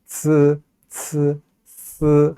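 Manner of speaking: 스 drawn out with the tongue flat; flat-tongue sibilant. Shown as a demonstration. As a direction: demonstrate slow 스 sounds, tongue uncurled. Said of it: "혀를 피고".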